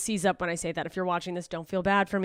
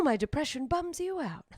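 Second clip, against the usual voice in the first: ordinary talk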